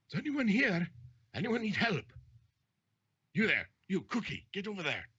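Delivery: high calls, then orders to the crew; captain yelling afar. Acted. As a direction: hail the distance as a ship's captain. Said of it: "'Cause you hear him again".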